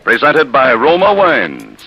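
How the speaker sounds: ordinary talk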